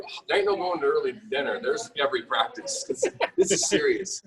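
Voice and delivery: fast, hammed-up panic over practice; mock panicked refusal. Dad panicking to his wife about baseball practice, fast mock alarm. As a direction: rattle it off like a frantic dad. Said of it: "I'm freaking out".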